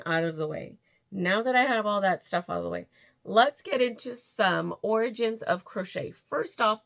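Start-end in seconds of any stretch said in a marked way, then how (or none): none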